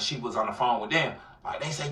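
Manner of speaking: normal talking voice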